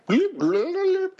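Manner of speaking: high rewinding bloops; tape rewinding. Shown as a demonstration. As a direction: make a quick tape rewind noise. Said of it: "that was my rewind noise".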